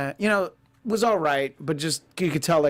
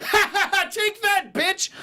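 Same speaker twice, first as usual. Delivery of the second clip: high-pitched cackling moviegoer impression; mock gleeful yell; slower than usual. Host imitating a disruptive moviegoer cheering a kill, high gleeful yell. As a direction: crow it like an overexcited heckler. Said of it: "he would yell about what was happening".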